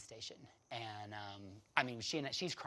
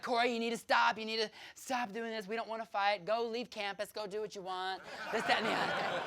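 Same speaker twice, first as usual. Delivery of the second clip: higher, louder teacher telling her to stop; history teacher; 10 semitones higher, 8 dB louder than usual. Retold or imitated. History teacher telling Corey to leave campus, higher and louder. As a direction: imitate the teacher shooing her off. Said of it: "the history teacher, was like".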